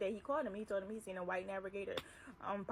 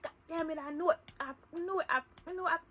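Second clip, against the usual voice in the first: boss cussing; 6 semitones higher than usual. Retold or imitated. Boss cussing, banging the table.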